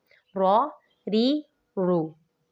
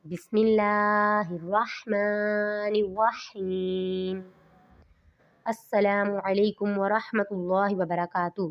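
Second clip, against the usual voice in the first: slow, drawn-out reverent recitation; much slower than usual. Acted. Teacher opening a Qaida lesson, slow reverent recitation. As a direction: intone each word slowly and piously.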